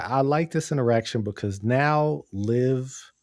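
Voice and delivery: ordinary talk, nothing marked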